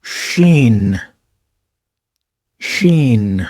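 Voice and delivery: شین with sh as in شمس; Arabic shin demo. Shown as a demonstration. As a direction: hush the sh in شین. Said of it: "جیسے شمس میں".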